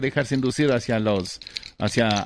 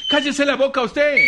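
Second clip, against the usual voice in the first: high, loud shut-down of an interrupter; sharp scolding; 13 semitones higher, 4 dB louder than usual. Acted. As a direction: cut them off with a high scolding outburst. (regaño fuerte y agudo)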